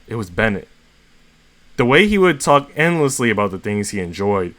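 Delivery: plain reading voice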